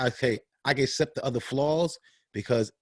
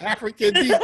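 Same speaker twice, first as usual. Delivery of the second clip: laughing; 5 dB louder than usual. Real, not acted. Jokes on Deke with an accent.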